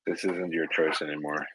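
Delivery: ordinary talk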